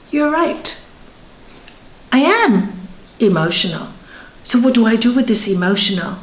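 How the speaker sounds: ordinary delivery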